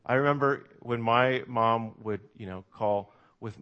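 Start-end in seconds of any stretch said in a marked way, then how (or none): none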